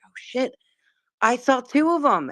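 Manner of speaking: high-pitched retold astonishment; excited realization. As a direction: voice jumps up in sudden realization. Retold realization on hearing sister's yes.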